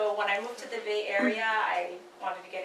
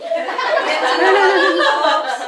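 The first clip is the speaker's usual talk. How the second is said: laughing; 10 semitones higher, 15 dB louder, much slower than usual. Real, not acted. Laughing self-intro at gathering.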